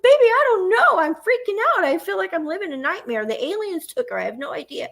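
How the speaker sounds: high, loud, panicked outburst to her son; mother tells her son she is losing it; freaking out. Retold or imitated. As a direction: let the panic spill out high and fast.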